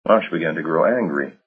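ordinary delivery

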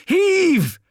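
very high, loud heave; yelled command. A narrator's reading. Corvo's last push on the statue, yell. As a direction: yell it with all your might. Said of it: "yelled Corvo".

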